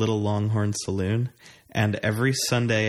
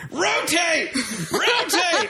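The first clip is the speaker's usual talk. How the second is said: high-pitched, full-throated hollered imitation of a loud drunk Texan; drunk Texan screaming; 3 dB louder, much slower than usual. Bingo player imitating a drunk Texan screaming at a chicken, loud high holler. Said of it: "loud, drunk Texan guy ... screaming at it".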